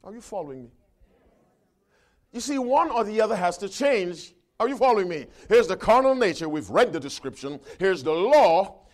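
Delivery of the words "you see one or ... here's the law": loud, higher, insistent pulpit voice; emphatic preacher's declaration; forceful preaching. Acted. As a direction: press the point home to the congregation. Presses the point on congregation.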